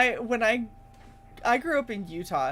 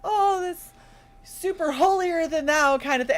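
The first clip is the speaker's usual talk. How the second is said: mock pious voice; 7 semitones higher than usual. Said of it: "people have thought of that as like".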